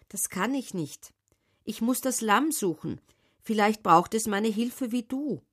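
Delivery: put-on an earnest boy explaining to a wolf; a hint of a tender boy; young shepherd boy. A narrator's reading. Simon talks to the wolf.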